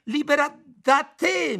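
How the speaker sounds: very high, loud and slow; yelled back. A narrator's reading. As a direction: fling the words back at him. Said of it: "mi urlò di rimando".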